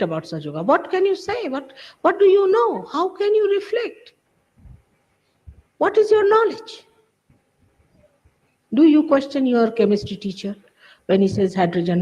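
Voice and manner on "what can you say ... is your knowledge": scornful challenge. Real, not acted. Scolding followers who question leaders.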